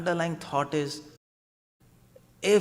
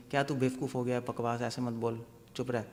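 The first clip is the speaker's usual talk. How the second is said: ordinary talk, nothing marked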